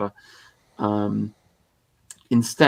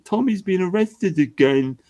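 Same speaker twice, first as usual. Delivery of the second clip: mock fan; 8 semitones higher than usual. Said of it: "and then people say".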